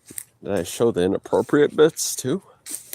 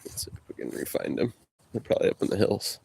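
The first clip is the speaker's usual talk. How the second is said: hushed whisper while stalking animals; breathy whisper, fast; 7 dB quieter, faster than usual. Real, not acted.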